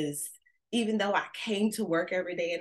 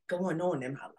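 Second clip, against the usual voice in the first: hushed aside; 4 dB quieter than usual. Real, not acted. Hushed confession.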